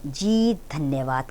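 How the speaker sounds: slow, soft, timid thanks; trembling voice. A narrator's reading. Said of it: "कांपते स्वर में".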